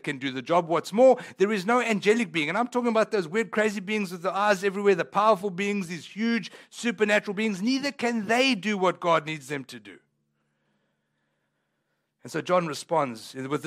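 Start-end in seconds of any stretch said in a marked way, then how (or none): none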